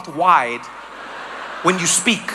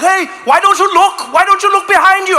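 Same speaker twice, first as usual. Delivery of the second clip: high, loud indignant yell; mock screamed scolding; retold scream; 9 semitones higher, 6 dB louder than usual. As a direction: imitate an irate cyclist yelling. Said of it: "One guy screamed at me".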